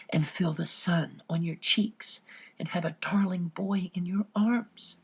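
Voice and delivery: whispered, slowing at the end; dreamy whisper. Acted. Anne confessing her crush on Peter, a soft whisper. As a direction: breathe it out like a secret daydream.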